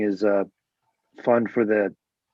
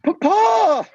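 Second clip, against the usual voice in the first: much higher and louder, delighted cry; gleeful squeal; 6 dB louder than usual. Retold or imitated. Trevor Noah spotting Stewart, mock-delighted high squeal. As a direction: squeal it like a thrilled kid. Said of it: "and he's like".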